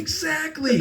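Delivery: high-pitched emphatic agreement; excited agreement. Real, not acted. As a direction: jump way up in pitch on it. Repeating the agreement.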